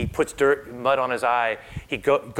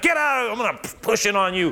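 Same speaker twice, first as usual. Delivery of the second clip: mock forceful; 7 semitones higher than usual. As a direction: ham up a pushy healer.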